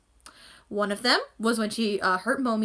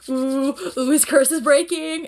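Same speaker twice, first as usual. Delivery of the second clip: louder and higher, slowed on curse breaking; excited gasp; 4 semitones higher, 8 dB louder, slower than usual. Retold or imitated. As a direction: gasp it out like a thrilled fan. Excited reaction to fraying rope.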